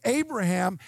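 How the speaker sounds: normal talking voice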